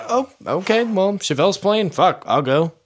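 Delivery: high-pitched retold reaction; airy, high "why not" quote; pleasantly persuaded. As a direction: lift the voice well up, easily talked into it. Acts out own reaction to lineup.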